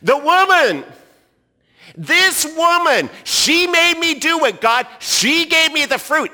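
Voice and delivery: high, loud mock whine pointing at the woman; Adam blaming. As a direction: play Adam passing the blame. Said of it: "notice what Adam does".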